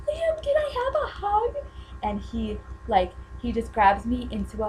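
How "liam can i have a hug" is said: very high-pitched eager plea; reenacted fangirl squeal. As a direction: squeak the request to your idol. Replays asking singer for hug.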